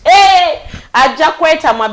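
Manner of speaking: a loud, high call for attention; sudden shout. Real, not acted. Host shouting to get her listeners' attention, loud. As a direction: shout it out to grab them. Calls out to audience.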